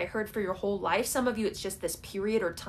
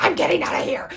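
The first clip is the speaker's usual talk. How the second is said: higher, louder fed-up cry; mock outburst; 8 semitones higher, 8 dB louder, faster than usual. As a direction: burst out like you've had enough. Imagined fed-up tenant bursting out.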